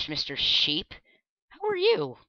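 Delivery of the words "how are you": high cooing at a pet; baby talk. Acted. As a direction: coo at a cute animal. Cooing at a sheep.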